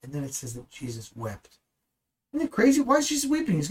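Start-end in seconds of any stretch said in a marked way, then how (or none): 2.3-3.6 much higher, louder disbelief; astonished rhetorical question; amazed question. Real, not acted. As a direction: marvel at it aloud. Preacher marvels at Jesus weeping.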